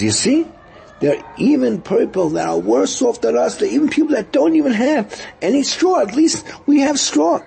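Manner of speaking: high, bright encouraging voice; consoling. Acted. Rabbi Akiva consoling his wife.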